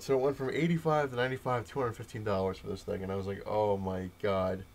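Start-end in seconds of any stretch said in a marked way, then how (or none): 3.5-4.6 a slow, drawn-out, louder oh my god; stunned disbelief. Retold or imitated. Retelling his reaction to the price, slow dazed disbelief. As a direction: let it drag, floored.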